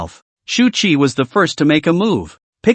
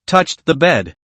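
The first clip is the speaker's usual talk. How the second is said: ordinary delivery, nothing marked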